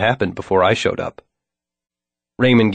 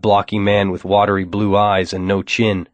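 plain reading voice

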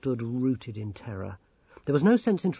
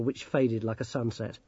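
ordinary delivery, nothing marked

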